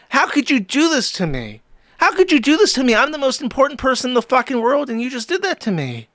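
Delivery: high-pitched, hammed-up betrayal; mock wounded outrage. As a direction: play the self-important victim, pitch soaring. Acting out an outraged entitled viewer.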